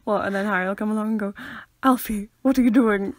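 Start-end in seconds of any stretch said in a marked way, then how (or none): 1.8-3.1 bad posh accent. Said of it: "That was a really bad posh accent".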